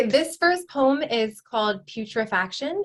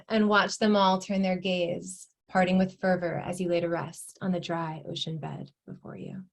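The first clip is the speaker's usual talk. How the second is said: measured recital with pauses between phrases; poetry reading; 3 semitones lower, 3 dB quieter than usual. Acted. Poet reading her poem on decay and alchemy, measured recital. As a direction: read it as a poem, letting lines settle.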